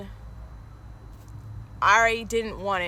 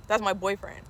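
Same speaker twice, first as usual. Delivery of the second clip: ordinary delivery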